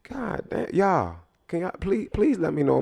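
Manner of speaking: high, fed-up appeal; mock exasperated teacher. Teacher role-play, appealing to the class in exasperation. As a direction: beg the class for backup in disbelief.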